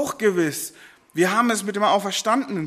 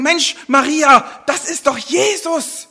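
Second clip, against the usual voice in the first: raised, loud, pleading outburst to Maria; loud excited plea, pitched up high; urgent call; 6 semitones higher, 6 dB louder than usual. Pretend. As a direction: call out to her as if you can't hold it in. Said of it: "würden am liebsten sagen".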